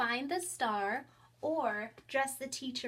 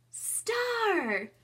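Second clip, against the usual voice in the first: high, loud cry of "Star!"; excited reveal; 10 semitones higher, 5 dB louder than usual. Shown as a demonstration. Teacher pretending to bring a star to a child, much higher and louder. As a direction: announce it like a big surprise. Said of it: "or I say".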